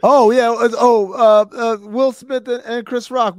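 excited. Real, not acted. Excited reveal of the answer.

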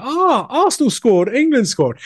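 put-on high whiny child complaint; whiny kid voice. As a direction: whine like a kid blaming mum. Kid complaining as mum enters.

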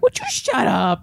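high, drawn-out put-on demand; hammed-up annoyed plea; mock exasperation. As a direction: stretch it out like a fed-up driver. Acted tapping on driver's window.

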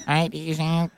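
mock line call as a computer. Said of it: "computer voice".

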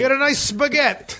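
hammed-up fake Italian. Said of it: "that's how you fake Italian".

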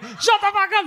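high-pitched exasperated yell; shouting into a phone to silence someone; retold yelling. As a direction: yell to cut the caller off. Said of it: "Yelling, I'm like".

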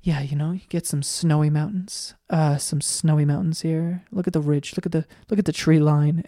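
hushed, gentle painter voice; soft Bob Ross murmur. Retold or imitated. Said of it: "he's just whispering".

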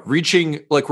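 ordinary talk, nothing marked

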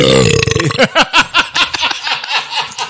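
high laughing mock belch; a posh belch, laughing; hammed-up upper-class belch; rich British belch. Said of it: "That was a rich British belch".

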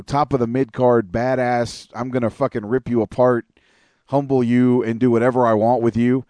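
normal talking voice

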